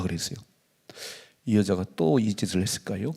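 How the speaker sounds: normal talking voice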